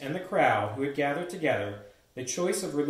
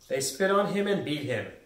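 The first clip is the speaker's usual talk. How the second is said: plain reading voice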